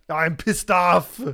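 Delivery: slow, loud Schwarzenegger growl; slow mock action-hero voice; Arnie impression. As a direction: deliver it like Arnie in Twins. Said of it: "Sound like Arnie and Twins".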